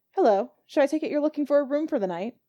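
put-on welcoming a stranger at the door, no accent; a hint of a friendly landlady; landlady May. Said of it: "I will not be having an Irish accent".

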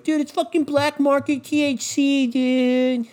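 high, slow, put-on stoner voice; mocking stoner dude. Mocking the vape crowd as a high, slow stoner dude. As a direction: drag it out like a stoner bro. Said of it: "everyone's like".

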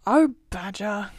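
Rat calling out to Badger; crying out. A narrator's reading. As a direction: call through a shut door. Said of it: "cried the rat".

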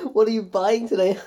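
hammed-up flirty question between weird laughs; mock flirting. Mocking the actress flirting at the auction, mock flirting. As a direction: mimic an awkward flirt. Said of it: "doing this really weird laugh".

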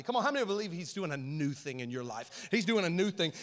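usual speaking voice

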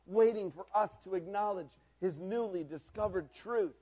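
normal talking voice

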